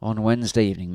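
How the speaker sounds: ordinary delivery, nothing marked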